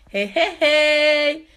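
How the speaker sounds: hey taquin, étiré et plus aigu. Real, not acted. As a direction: refuse en riant à moitié. Taquine sur son astuce secrète. (taquinerie espiègle)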